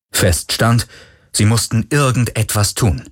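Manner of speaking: usual speaking voice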